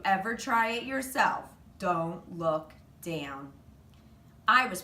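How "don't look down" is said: slow, ominous don't look down; slow it right down like a dire warning. A narrator's reading. Warning about the dangerous dive.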